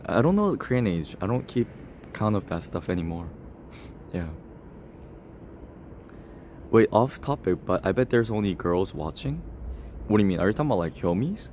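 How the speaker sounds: ordinary delivery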